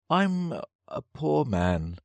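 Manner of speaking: high, slow, whimpering plea; a cowering hatter begging, pitched high; pleading Hatter. Acted. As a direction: whine it out pitifully.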